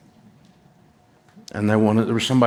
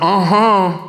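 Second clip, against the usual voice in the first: eager uh-huh; 8 semitones higher, 6 dB louder than usual. Retold or imitated. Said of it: "And I went".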